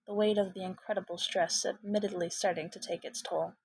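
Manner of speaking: plain reading voice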